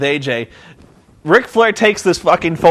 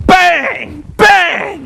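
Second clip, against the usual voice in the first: high, explosive imitation of gunshots; mimicked bangs, pitched way up; 12 semitones higher, 4 dB louder than usual. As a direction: fire each one off as a shout. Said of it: "like gunshots".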